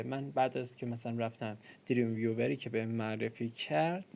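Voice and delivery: ordinary talk, nothing marked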